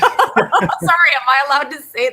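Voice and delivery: giggling through a high, loud "sorry"; laughing apology. Real, not acted. Laughing to the host after swearing about Chucky. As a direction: laugh it out, half embarrassed.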